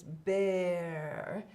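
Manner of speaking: ordinary talk, nothing marked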